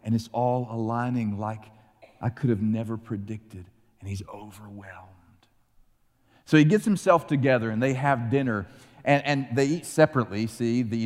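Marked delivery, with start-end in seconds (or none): 4.0-5.1 sudden hushed whisper, breath only; overwhelmed whisper. Acted. Storyteller whispers that Joseph is overwhelmed. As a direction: drop to a whisper as if overcome. Joseph overcome by his brothers.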